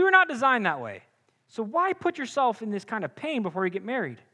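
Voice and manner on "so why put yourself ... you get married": ordinary delivery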